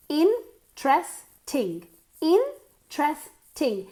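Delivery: "Interesting" split slowly as in-tres-ting; a gap after each of the three beats of "Interesting"; three syllables. Shown as a demonstration. Teacher breaking "Interesting" up for learners, slow in-tres-ting. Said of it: "Three syllables".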